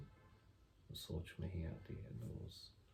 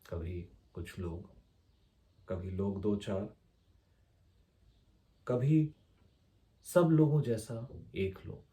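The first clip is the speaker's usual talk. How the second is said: measured poetry reading, lines launched loud then easing; poem recitation; 2 semitones higher, 12 dB louder than usual. Acted. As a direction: recite line by line with long pauses, lifting key openings. (कविता पाठ, ठहर-ठहर कर)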